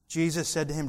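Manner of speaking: usual speaking voice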